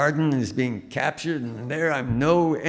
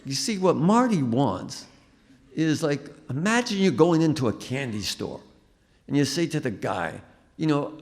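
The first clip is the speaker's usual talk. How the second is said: a higher, lively director's voice; pitched-up coaching voice; 6 semitones higher than usual. Retold or imitated. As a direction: coach the actor like an excited director. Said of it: "He said".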